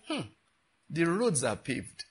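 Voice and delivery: normal talking voice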